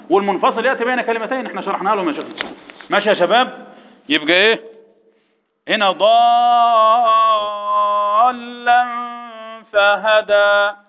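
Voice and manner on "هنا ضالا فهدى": slow chanted recitation, the alif of "ضالا" held long; Quran recitation, long madd. Shown as a demonstration. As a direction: hold "ضالا" six counts then "فهدى". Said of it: "مد لازم ايه يا ابني؟". (ترتيل بمد لازم في ضالا)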